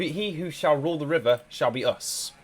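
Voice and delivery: normal talking voice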